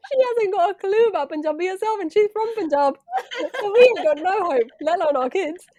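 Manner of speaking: high, loud, laughing it out; can't stop laughing at Mum. Real, not acted. Telling her sister about Mum, laughing hard.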